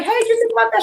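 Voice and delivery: acted prompting. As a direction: pitch it up high, as if checking in. Re-enacting her prompt to Sherry.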